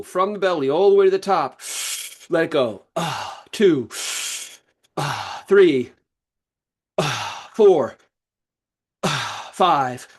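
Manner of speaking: forceful breathwork coaching, punched numbers; energetic breath count. Shown as a demonstration. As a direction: call out the count between big audible breaths. Counts the forceful deep breaths aloud.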